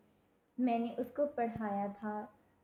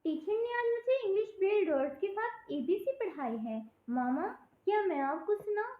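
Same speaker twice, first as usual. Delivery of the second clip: put-on much higher, thrilled child calling her mother; excited little girl; 9 semitones higher, 3 dB louder than usual. Little girl running to her mother, excited and much higher. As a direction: burst in bursting with pride like a small kid. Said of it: "اس نے بتایا".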